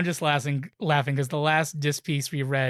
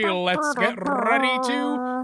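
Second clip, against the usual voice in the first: slow, drawn-out higher "rumble" call; WrestleMania announcer; 8 semitones higher, much slower than usual. Acted. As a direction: stretch it out like the classic ring call. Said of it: "in my best WrestleMania announcer voice".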